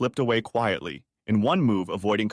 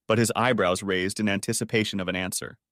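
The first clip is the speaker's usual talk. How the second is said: usual speaking voice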